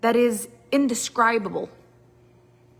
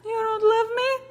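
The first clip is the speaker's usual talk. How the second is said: hurt disbelief; 12 semitones higher, slower than usual. Retold or imitated. Partner reacting to a flat yes with hurt disbelief. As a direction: cry out as if betrayed.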